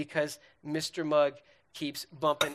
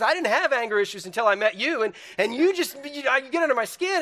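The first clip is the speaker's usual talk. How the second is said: high, loud husband's comeback; mock angry rant; 7 semitones higher, 5 dB louder than usual. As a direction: play a riled husband ranting, building higher. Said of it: "You got an anger issues".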